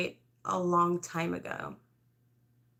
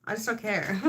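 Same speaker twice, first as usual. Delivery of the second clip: ordinary talk, nothing marked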